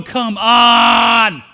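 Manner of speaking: loud, exasperated yell; impatient shout. Retold or imitated. Mary on the donkey urging Joseph, loud impatient shout. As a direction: bark it out, you're in labour and out of patience. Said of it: "Mary's sitting on the donkey going".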